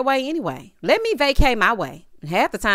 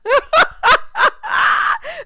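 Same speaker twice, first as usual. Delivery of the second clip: high, loud burst of laughter; real howl of laughter; shrieking laugh; 6 dB louder than usual. Real, not acted. Laughing at her own creeping joke, loud high howl.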